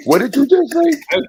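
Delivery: high, squeaky demand; incredulous squeal. Pretend. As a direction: shoot it up in disbelief. Repeats demand much higher.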